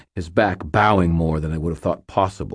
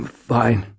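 groaning; 6 semitones higher than usual. Acted. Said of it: "Daniel groaned from the ground".

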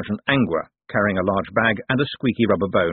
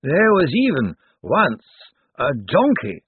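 higher, slow, dusty legal voice; dry old zombie lawyer; 8 semitones higher, 4 dB louder, slower than usual. Acted. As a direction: ancient lawyer weighing every word. Slant cites case law.

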